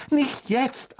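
high, sing-song motherly voice; a kind mother ushering the guest first; fussing mother. A narrator's reading. Mrs Weasley voiced by narrator.

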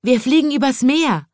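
high chirpy birds; bird voices. A narrator's reading. Birds answering the chimney sweep, high and chirpy. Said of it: "antworteten die Vögel".

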